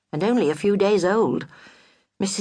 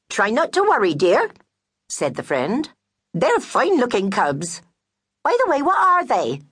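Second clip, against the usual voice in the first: high fussy friend; 6 semitones higher than usual. Acted. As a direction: coo at the widow, then pry. Narrator voices the friend.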